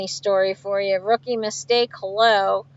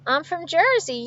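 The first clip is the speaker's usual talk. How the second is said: ordinary talk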